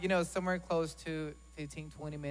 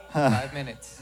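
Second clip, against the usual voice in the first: loud joking; 17 dB louder, much slower than usual. Pretend. Joking about short sermons.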